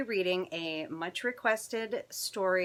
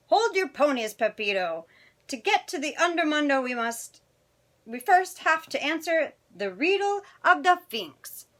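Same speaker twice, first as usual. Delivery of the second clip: higher Spanish-flavoured character voice; Don Diego; 7 semitones higher, 7 dB louder than usual. Acted. Biggest Chihuahua to Pepito, high-pitched and commanding. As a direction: play the pack leader calling a halt, pitched up.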